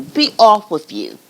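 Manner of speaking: loud gruff order; troll dismissal. Acted. Troll sending the goat away, loud. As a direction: send the goat off.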